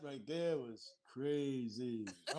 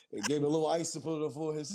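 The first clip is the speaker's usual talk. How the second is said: ordinary talk, nothing marked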